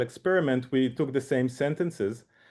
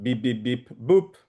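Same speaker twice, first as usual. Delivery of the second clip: beep tones with deviant; 4 dB louder than usual. Shown as a demonstration. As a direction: imitate tones with an odd last one. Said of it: "sorry, I will do it".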